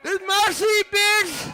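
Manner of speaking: high, loud, shouted snap; defiant yell. Retold or imitated. Comic on the bus yelling at the old lady, high and loud. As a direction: bark it right back at her.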